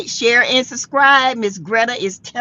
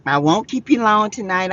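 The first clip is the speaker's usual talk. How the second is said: ordinary talk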